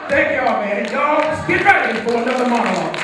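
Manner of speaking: loud, announcing sign-off to the crowd; loud thanks. Real, not acted. Comedian loudly thanking the crowd and announcing a monologue. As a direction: call it out to the audience.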